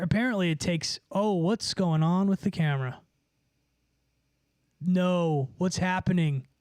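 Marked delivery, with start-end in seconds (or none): none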